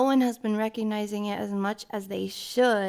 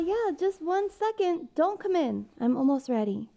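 ordinary talk, nothing marked

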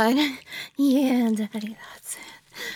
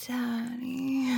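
ordinary talk

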